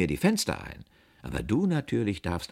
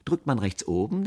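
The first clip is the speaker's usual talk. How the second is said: plain reading voice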